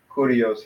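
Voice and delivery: Kurios, accent on the opening KU; stress on first syllable. Shown as a demonstration. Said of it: "just say it like that".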